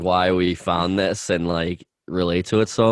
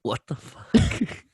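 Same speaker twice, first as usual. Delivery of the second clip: slow and higher, stunned; shocked disbelief; 8 semitones higher, 3 dB quieter, much slower than usual. Real, not acted. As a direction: drag it out in disbelief. Hears of headless chicken.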